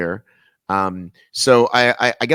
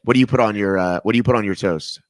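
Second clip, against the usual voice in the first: ordinary talk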